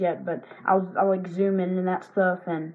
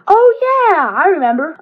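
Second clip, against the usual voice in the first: sudden recall; 9 semitones higher, 8 dB louder than usual. Real, not acted.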